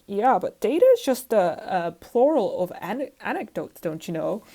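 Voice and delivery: a hint of Canadian accent, mocking her. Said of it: "I need to do a Canadian accent for this".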